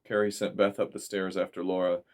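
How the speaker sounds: usual speaking voice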